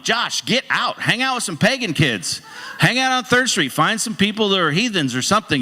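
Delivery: high, animated push; pastor's urging. Retold or imitated. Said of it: "And I'm like".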